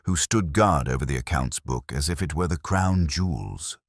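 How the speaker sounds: plain reading voice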